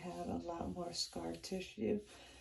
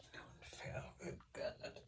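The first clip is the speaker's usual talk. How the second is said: whispering; 9 dB quieter than usual. Real, not acted. Muttering to herself, pad falls.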